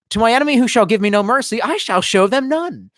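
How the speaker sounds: high, declaimed saying, slowing to the end; mock proverb recital. Quoting a proverb to mom, grandly declaimed. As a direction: proclaim it like a solemn warrior's creed.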